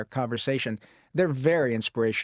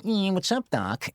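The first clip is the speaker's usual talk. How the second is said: a light Bugs Bunny drawl; 7 semitones higher, slower than usual. Retold or imitated. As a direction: toss off a quick Bugs Bunny line. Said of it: "he just said".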